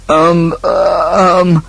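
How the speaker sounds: drawn-out, hesitant ums; stall, hunting for an idea; stammering. A narrator's reading. Eldest son with no plan yet, stammering. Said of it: "stammered the eldest son".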